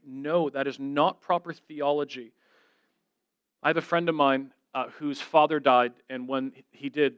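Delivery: ordinary talk, nothing marked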